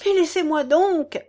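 loud, high cry to be let go; desperate husband shouting at his wife. A narrator's reading. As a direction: shout him away in despair. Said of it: "cria-t-il".

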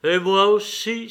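lively acted outburst; eager cry. A narrator's reading. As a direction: cry out keenly, chiming in. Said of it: "s'écria Pierre".

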